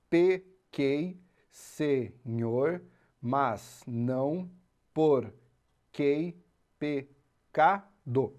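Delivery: "Pequei, Senhor, mas não porquei pecado" stretched out slowly, each syllable apart; slow syllable scansion. Shown as a demonstration. Teacher scanning the verse slowly to count ten syllables. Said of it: "Vamos separar em sílabas poéticas".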